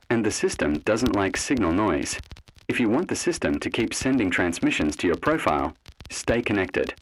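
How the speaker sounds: normal talking voice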